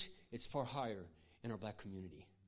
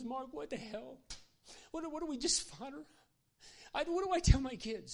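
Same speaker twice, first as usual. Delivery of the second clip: high, teary and rising louder; crying man pleading; 13 semitones higher, 4 dB louder than usual. Retold or imitated. Worker asking pastor what to tell kids, high and teary. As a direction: let it crack into a desperate plea. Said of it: "He started crying".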